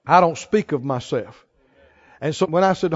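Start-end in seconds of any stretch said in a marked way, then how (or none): 0.1-1.4 quiet voice of the Spirit. Retold or imitated. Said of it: "He told me".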